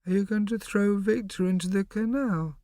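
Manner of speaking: put-on high small child voice; a curious little kid; little boy voice. A narrator's reading. Bo's voice.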